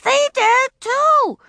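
put-on high, loud child's shout. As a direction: yell it in outrage. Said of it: "she shouted".

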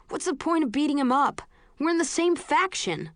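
pleading recruit. Acted. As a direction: plead against the fight. Al refuses to fight.

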